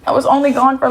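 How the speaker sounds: normal talking voice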